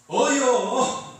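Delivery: loud, impatient Chungcheong 어여; curt, slightly annoyed. Shown as a demonstration. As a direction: snap 어여 out with a touch of irritation. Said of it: "약간 좀 화를 내면서".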